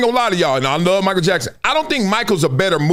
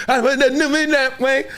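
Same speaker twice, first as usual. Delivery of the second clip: put-on little girl voice; 6 semitones higher than usual. Dad imitating his six-year-old singing in the car, high. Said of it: "With a girl voice".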